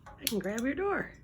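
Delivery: ordinary delivery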